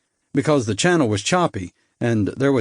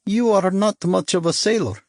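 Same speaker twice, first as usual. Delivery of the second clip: doleful; 8 semitones higher than usual. A narrator's reading. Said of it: "he said dolefully".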